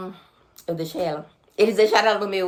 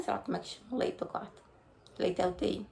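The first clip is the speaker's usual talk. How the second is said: muttered aside; 12 dB quieter than usual. Real, not acted. Unsure aside to herself.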